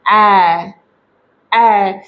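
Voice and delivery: ए held long and open; extended vowel. Shown as a demonstration. Teacher modelling the stretched ए sound for parents. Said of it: "हमें थोड़ा इस sound को extend करना है".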